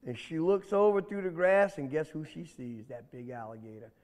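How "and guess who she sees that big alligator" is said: ordinary delivery, nothing marked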